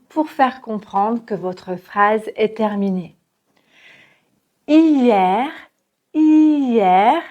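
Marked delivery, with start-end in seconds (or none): 4.7-7.2 hier with an open E and the R pronounced; hier, open è and a light R. Shown as a demonstration. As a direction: say hier slowly, open E, sound the R. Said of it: "ici le E R, ça se prononce avec un E ouvert".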